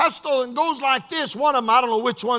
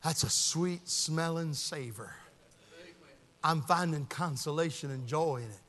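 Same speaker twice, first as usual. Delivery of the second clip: low, soft, savoring murmur; savoring a fragrance as Paul; 9 semitones lower, 13 dB quieter than usual. Acted. Paul in prison catching Philemon's fragrance, savoring it softly. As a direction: linger quietly over a lovely scent. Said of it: "Paul goes".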